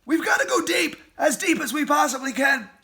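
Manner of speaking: high, loud urging; driving command; urgent. Acted. Fox urging family to dig.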